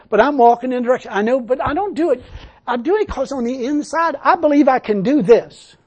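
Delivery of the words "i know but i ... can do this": usual speaking voice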